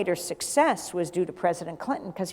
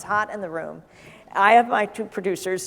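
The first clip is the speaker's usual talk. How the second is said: ordinary talk, nothing marked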